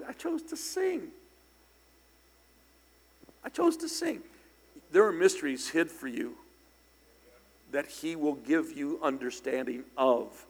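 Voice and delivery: normal talking voice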